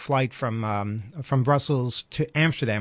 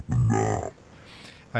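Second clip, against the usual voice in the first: slowed-down voice. Real, not acted. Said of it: "not dragons".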